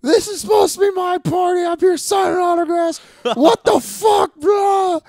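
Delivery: put-on a wild drunken tantrum yelled at the bouncer; furious rant. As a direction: relive going ape shit at the club. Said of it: "I fucking go ape shit".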